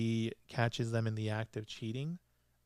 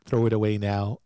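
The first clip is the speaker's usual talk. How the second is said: angry retold order; 6 dB louder than usual. Angry detective orders the husband to clean up, mock-stern and building. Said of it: "he looks very angry".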